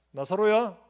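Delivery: loud summons into the tomb; Jesus calling the dead man, loud command. Retold or imitated. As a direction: call Lazarus out with full authority. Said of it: "말씀하셨어요".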